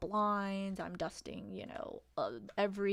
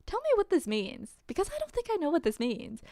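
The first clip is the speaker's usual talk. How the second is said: higher, a touch louder baffled plea; half-laughing disbelief at the message; incredulous plea; 9 semitones higher, 3 dB louder than usual. Retold or imitated. Asking husband about weird text.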